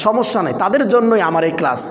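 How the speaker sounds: ordinary delivery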